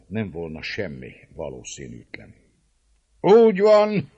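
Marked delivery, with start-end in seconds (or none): 3.2-4.1 put-on loud, high drunken toast; drunken old man's shout; old Székely toasting. A narrator's reading. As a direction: raise the cup and cry it out. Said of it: "szólt egy vén székely".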